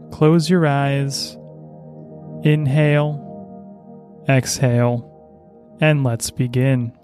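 very slow, calm breathing guidance; guided meditation. Acted. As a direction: draw out each breath cue gently. Leads breathing before reading.

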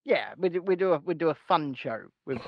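usual speaking voice

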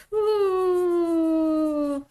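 high whooping cry; high cheer. Real, not acted. Cheering at the end of the vlog, high whoop. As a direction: let out a joyful woo.

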